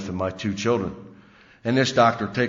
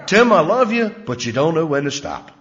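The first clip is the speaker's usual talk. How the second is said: much higher and louder, fed up and shouting it; exasperated outburst; 7 semitones higher, 7 dB louder than usual. Retold or imitated. New girlfriend yelling at her drinking boyfriend, loud and exasperated. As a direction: blurt it out at full volume, out of patience. Girlfriend repeats wife's words.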